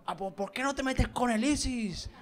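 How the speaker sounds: high-pitched put-on protest; mock indignant. Offended Andalusians challenging the comic, mock indignant. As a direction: imitate an offended heckler, pitched right up. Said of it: "algunos te dicen".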